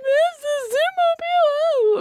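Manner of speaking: put-on slow, very high child wail; hammed-up wailing little boy; imitated bawling. Mom mimics her bawling son, mock wail. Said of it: "and he's bawling".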